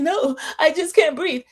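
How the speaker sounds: high-pitched fake panic; hammed-up distressed refusal in a high voice; mock hyperventilating. Recapper mimics Margot answering the producer, mock panic. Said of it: "She's hyperventilating".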